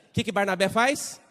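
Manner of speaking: ordinary talk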